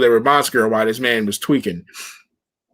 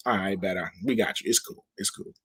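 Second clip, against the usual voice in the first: quiet nervous placating, much softer; much softer, backing down nervously; nervous hush; 7 semitones lower, 12 dB quieter than usual. Retold or imitated. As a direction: soothe him quickly and softly. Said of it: "And they're like".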